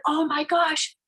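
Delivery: usual speaking voice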